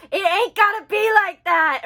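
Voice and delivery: loud, high hillbilly imitation; mock hillbilly. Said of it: "hillbilly English".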